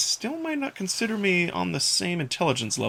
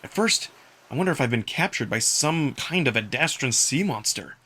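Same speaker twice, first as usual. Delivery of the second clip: ordinary delivery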